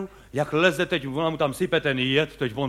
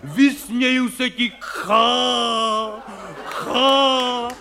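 hammed-up heroic actor; 4 semitones higher, much slower than usual. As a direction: roar defiance at death like a ham tragedian. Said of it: "křičej tam, prostě řvou".

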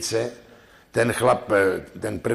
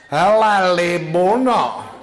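high, loud, slow call to a friend with a laugh; hammed-up gossip calling her pal; gossiping woman; 7 semitones higher, 7 dB louder, slower than usual. One woman hails her friend Libuna, high and loud.

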